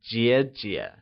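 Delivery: "jie jie" dipping on the first syllable; falling-rising tone. Shown as a demonstration. Teacher models the word for elder sister, "jie jie" in falling-rising tone. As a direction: dip and lift the first half of "jie jie". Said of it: "pronounced using the falling rising tone".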